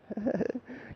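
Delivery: a sudden high burst of laughter. Real, not acted.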